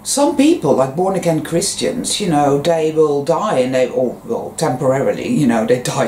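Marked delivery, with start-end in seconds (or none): none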